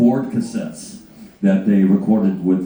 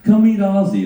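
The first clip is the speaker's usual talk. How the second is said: high, louder cheery mock call; higher imitated invitation; Sharon; 10 semitones higher, 4 dB louder than usual. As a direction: usher him in brightly. Voicing Sharon.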